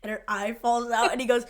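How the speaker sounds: ordinary delivery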